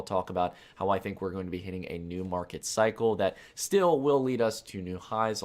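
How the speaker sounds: ordinary talk, nothing marked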